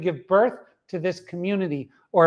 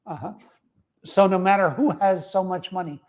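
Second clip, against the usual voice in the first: ordinary delivery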